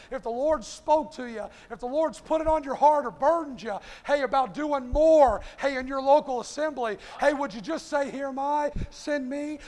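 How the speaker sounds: rising sermon cadence with loud punched words; preaching. Acted. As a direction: call the church to stand in the hedge. Preaching the invitation to the church.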